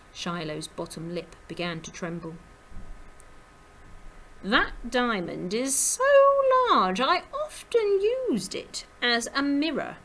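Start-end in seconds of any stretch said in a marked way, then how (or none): 4.4-10.0 high, swooping dandy voice; hammed-up elegant show-off; elegant, vain rabbit. A narrator's reading. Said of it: "said the elegant grey-haired Clooney".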